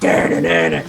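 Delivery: low, vocalized guitar riff; sung guitar riff. Retold or imitated. Comic quoting a song intro, mouthed guitar riff.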